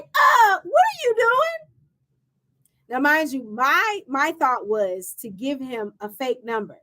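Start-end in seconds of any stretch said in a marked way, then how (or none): 0.0-1.7 imitated friend's high, loud protest; shocked friend. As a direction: cry out high as the scandalized friend. Voices her shocked friend.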